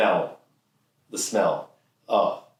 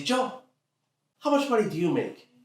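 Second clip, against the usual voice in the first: high-pitched father impression; pointed question in an acted dad voice. Dad asking teen about pay, pointedly.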